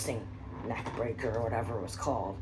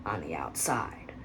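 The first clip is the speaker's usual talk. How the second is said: whispered; 9 semitones lower, 5 dB quieter, slower than usual. Real, not acted. Self-correction, whispered.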